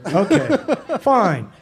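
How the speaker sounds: high, laughing mock agreement; mock acceptance. Actor accepting the director's refusal, mock-cheerful agreement. Acting out his reply to refusal.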